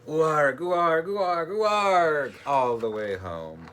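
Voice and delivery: loud, slow growling pirate "ooarg". A narrator's reading.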